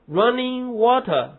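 slow, running and water both stressed, water falling; both words stressed. Shown as a demonstration. Teacher showing students both words stressed. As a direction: give running and water equal weight. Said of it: "都重读".

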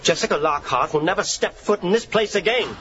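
mock English accent as Jessica; hammed-up British soap villain. Actor practising his co-star's role in an English accent. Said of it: "Is that supposed to be me?".

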